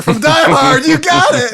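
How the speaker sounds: loud and high, laughing; excited shout. Real, not acted. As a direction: shout it out gleefully. Excited Die Hard joke.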